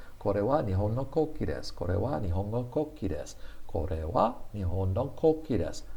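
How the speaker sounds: rattled off fast. Shown as a demonstration. Teacher showing how fast natives say it, rushed. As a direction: race through the sentence. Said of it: "I say it so fast".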